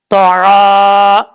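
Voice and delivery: तो आ stretched; recited slowly. Shown as a demonstration. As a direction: hold the आ long. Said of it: "आइन अलिफ ज़बर आ". (धीमी तिलावत)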